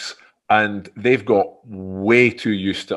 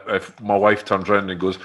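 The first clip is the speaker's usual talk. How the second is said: ordinary delivery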